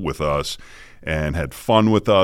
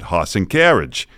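HOSS AND CARRIAGE, the R dropped Boston-style; Boston accent. Retold or imitated. Student answering the voice teacher, Boston accent. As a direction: imitate your own old Boston accent. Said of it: "what happened to Larry Potash's Boston accent".

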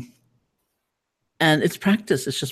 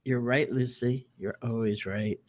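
defeated; 2 semitones lower, 6 dB quieter than usual. Retold or imitated. Giving in to the critic.

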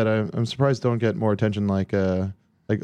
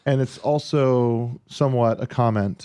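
slow; 2 semitones higher, slower than usual. Acted. Slow mock question setup.

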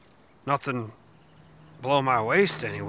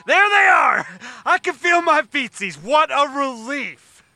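high, loud relieved shout; excited shout; 7 semitones higher, 3 dB louder, slower than usual. Acted. Cop finds his feet.

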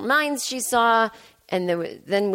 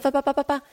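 mock blabbing; 6 semitones higher, faster than usual. Mocking endless complaining.

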